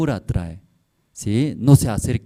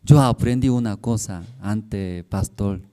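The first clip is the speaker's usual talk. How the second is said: normal talking voice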